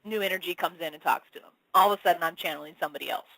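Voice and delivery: usual speaking voice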